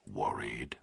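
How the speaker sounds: slow, low, menacing whisper; distorted nightmare voice. A narrator's reading. Kidnapper on the phone to Burke, slow eerie whisper. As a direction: breathe it like a monster on the line.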